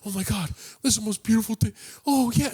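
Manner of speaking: high, fast gushing squeal; excited like kids. Retold or imitated. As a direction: gush like a kid seeing a wonder. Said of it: "they sound like kids".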